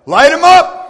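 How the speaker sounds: high loud shout of Light 'em up!; rallying shout. Real, not acted. Preacher shouting to his congregation to raise their lights, full cry. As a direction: blast it out to the whole room.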